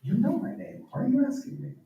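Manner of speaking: high, hushed, baffled asking; a touch of stunned disbelief; stupefied. Retold or imitated.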